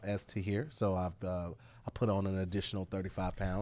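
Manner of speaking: a hint of a polished documentary narrator; deep intellectual voice. Podcast hosts teasing, speaking in a mock polished voice. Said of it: "you are full of 'em".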